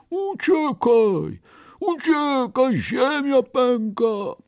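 slow, high-pitched roaring warning; bear's roar. A narrator's reading. Scared bear looks back at another bear, roaring. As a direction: roar the alarm, drawing it out. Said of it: "ryknął".

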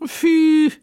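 very high, loud 'pfff'; dismissive scoff. A narrator's reading. Merchant waves off the boast, scoffing. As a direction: scoff it away in a squeal. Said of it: "nie przejął się kupiec".

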